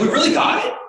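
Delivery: high-pitched incredulous question; acted-out amazed double-check; disbelieving. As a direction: imitate astonished good news sinking in. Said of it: "he just couldn't believe it".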